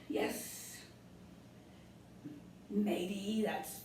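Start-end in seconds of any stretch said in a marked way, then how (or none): none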